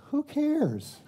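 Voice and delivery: high, laughing who-cares toss-off; dismissive husband. Retold or imitated. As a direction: brush it off with a laugh.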